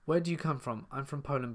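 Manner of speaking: usual speaking voice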